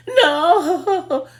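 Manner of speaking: high, loud "no" breaking into laughter; laughing mock wail. Pupil answering teacher about the hallway, laughing wail. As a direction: act out a helpless giggly protest.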